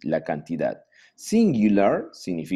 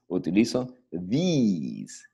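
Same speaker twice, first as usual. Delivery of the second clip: normal talking voice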